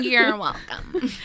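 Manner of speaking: slow it down and lay the sarcasm on thick; slow mock sarcasm. Host at the door turning the guest away, slow mock sarcasm.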